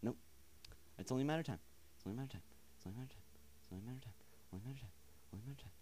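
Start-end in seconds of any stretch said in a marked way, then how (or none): 2.0-5.7 much softer, under the breath; muttered mantra. Real, not acted. Player awaiting a scare repeats it to himself, muttered mantra. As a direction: mumble it over and over to yourself.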